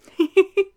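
high, loud delighted giggle. Acted. Delighted at her lover's try, a loud high giggle. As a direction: burst into a bright high giggle.

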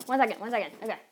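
ordinary delivery

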